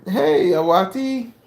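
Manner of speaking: high, slow call across the room. Real, not acted. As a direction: call her name out, drawn out. Calling out to daughter.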